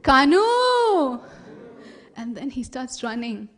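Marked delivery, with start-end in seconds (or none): 0.0-1.2 high, loud drawn-out calls; long falling shouts of the name; distant calling. Acted. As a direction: call out far across the fields. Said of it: "What does he hear?".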